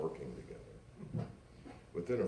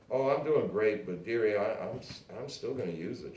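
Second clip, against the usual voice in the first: smiling; 5 semitones higher, 6 dB louder than usual. A narrator's reading. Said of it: "she smiled".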